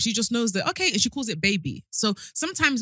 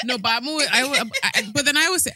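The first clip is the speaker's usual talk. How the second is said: ordinary talk, nothing marked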